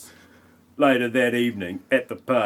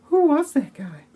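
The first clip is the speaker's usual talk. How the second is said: much higher squeaky guest voice; mock party guest. Voicing a second guest asking about the stranger, very high and squeaky.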